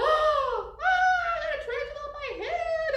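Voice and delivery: high, loud, fast mock scream; mock panic. Teacher playing scared of the toy spider, shrieking high. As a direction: pretend the spider landed on you. Tarantula lands on her head.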